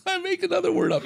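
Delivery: laughing through the words; giggling at his own joke. Real, not acted. Laughing at his made-up word.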